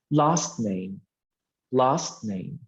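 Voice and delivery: "last" stressed, "name" dropping away; stress on first word. Shown as a demonstration. Teacher repeating an example for students, stress on "last". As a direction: lean on "last", let "name" fall. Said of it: "So the stress is on the first word".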